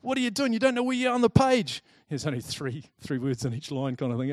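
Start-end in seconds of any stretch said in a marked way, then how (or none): 0.0-1.8 higher, louder stern teacher telling off a pupil; growling teacher. Retold or imitated. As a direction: snap at the boy like a cross teacher. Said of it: "teacher growls at me".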